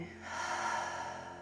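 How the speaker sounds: soft, voiceless breath out; long exhaling sigh. Shown as a demonstration. Models the exhale to let go.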